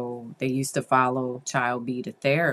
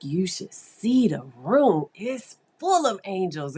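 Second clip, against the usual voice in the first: put-on higher, wonder-filled retelling of an excited child; 5 semitones higher, 5 dB louder than usual. Child describing a room of angels to the therapist, excitedly. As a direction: imitate a child thrilled by what they see. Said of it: "child B is really excited".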